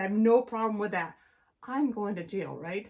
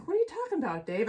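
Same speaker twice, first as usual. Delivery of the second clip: higher, playing dumb; feigned innocence; 6 semitones higher, faster than usual. Wife lying to her husband about speeding, playing innocent. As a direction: act like you know nothing. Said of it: "I try, like".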